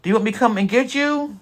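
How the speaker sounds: worried wife. Retold or imitated. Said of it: "She said".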